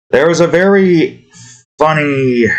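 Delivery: high, lilting restart on "very funny". Shown as a demonstration. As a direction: pitch the phrase up high, falling at the end. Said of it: "the wrong one".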